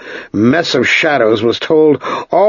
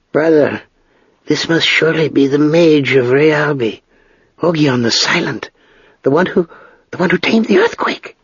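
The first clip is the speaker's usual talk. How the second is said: higher-pitched witch voice, halting and rising at the end; awed stage whisper, pitched up; witch's urgent whisper; 5 semitones higher than usual. A narrator's reading. Witch confiding to the smith who the stranger is, hushed awe. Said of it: "The witch whispered to the smith".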